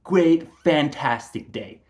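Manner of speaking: sarcastic sergeant's comeback; sarcastic reply. Retold or imitated. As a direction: stretch it out mockingly. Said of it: "And he said, like".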